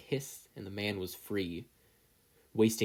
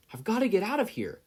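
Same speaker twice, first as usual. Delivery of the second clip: panicked; 11 semitones higher, 4 dB louder than usual. A narrator's reading. Yagi's panicked thought.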